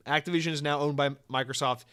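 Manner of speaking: ordinary talk, nothing marked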